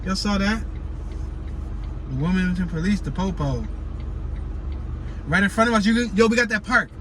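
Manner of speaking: usual speaking voice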